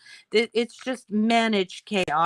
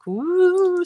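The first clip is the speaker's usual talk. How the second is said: spooky woo; 11 semitones higher, 7 dB louder than usual. Pretend. Describing spooky foggy morning.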